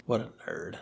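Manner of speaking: whispered tease. Real, not acted. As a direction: whisper it like a secret jab. Teasing the model town hobby.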